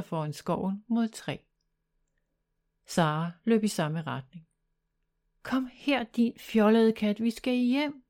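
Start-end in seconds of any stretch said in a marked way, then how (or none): 5.4-8.0 higher, scolding call to the cat; shouting. Acted. Sara scolds her cat to come home, shouting. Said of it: "råbte Sara".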